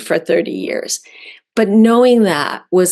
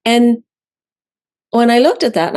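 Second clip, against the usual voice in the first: ordinary talk, nothing marked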